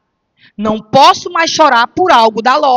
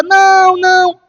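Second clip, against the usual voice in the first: put-on whiny child; 5 semitones higher, 4 dB louder, slower than usual. Child refusing to give bear.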